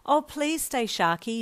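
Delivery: eager pleading. A narrator's reading. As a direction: beg him to stay. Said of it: "you pleaded".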